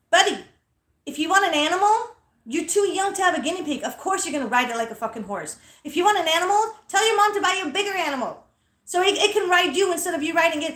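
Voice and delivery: high-pitched, emphatic mock scolding; loud lecturing. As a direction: lecture the kid at high volume. Acted loud lecture to little boy.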